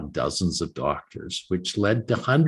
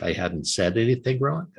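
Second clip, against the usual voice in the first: ordinary delivery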